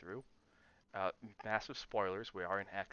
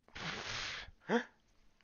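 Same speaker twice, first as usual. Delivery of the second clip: sharp surprised gasp; a quick gasp of surprise; 9 semitones higher, 6 dB louder than usual. Real, not acted. Surprised at something on screen.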